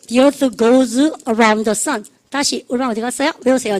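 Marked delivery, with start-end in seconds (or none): 0.1-2.0 each word dragged out very slowly; slow choral model. Shown as a demonstration. Teacher leads the class in reciting the rule sentence, very slow. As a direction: drill pace, word by word. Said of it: "시작".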